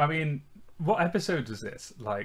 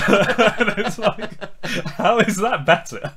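laughing; 6 semitones higher, 6 dB louder, slower than usual. Real, not acted. Laughing at absurd line.

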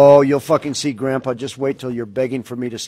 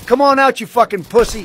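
taunting shout; 8 semitones higher, 5 dB louder than usual. Real, not acted. Taunting opponent in game.

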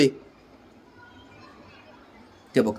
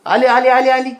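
higher and louder "आली, आली"; excited pet call; 8 semitones higher, 3 dB louder than usual. Real, not acted. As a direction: hype the dog up, it's coming. Announcing food to pet.

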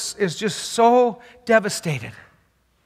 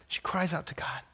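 hushed, breathless whisper; 15 dB quieter than usual. Real, not acted. Preacher telling the congregation of her cry, whispered. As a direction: drop to a whisper, barely there.

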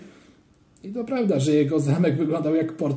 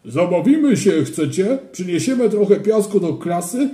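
put-on louder, sly teenager's voice; scheming older boy; 4 semitones higher, 5 dB louder than usual. A narrator's reading. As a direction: pitch the prank like a mischievous big kid. Older boy proposing a prank. (łobuzerski ton starszego chłopaka)

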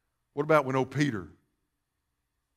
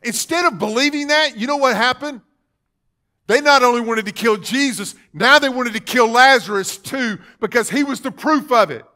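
higher, louder fervent preaching; preaching at full force; 8 semitones higher, 8 dB louder than usual. Acted. Preacher to congregation on unbelief, full-force preaching. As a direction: drive it home to the church.

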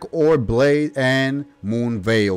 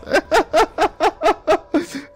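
high-pitched staccato laugh; startled high giggling fit; 3 dB louder than usual. Real, not acted. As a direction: burst into rapid high cackling. Laughing at unexpected boss.

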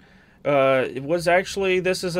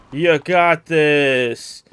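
loud, very slow, stretched-out cheer; long, loud, drawling pep talk; drawn-out encouragement; 7 dB louder, much slower than usual. Real, not acted. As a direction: drag out every word loudly. Urging on during game fight.

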